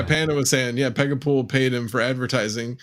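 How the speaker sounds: ordinary talk, nothing marked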